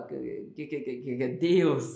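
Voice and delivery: nervous stammer, mildly voiced; stuttering Moses. Moses stammering nervously to God, light stutter. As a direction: stutter out each que before Deus. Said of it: "um gago deve falar assim com Deus".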